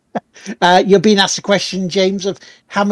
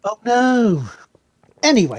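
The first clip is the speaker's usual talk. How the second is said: imitating Clarkson; 4 semitones higher than usual. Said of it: "It's Clarkson".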